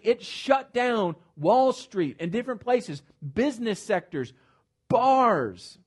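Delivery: climbing, impassioned pulpit delivery; full-force preaching. Acted. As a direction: lift it and drive it home like a revival preacher. Preaching revival, rising to full force.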